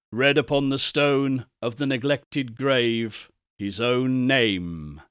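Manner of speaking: ordinary delivery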